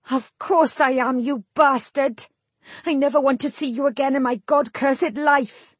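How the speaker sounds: high, angry outburst; furious rejection. A narrator's reading. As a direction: throw it back at him in fury. Jill furiously rejects him.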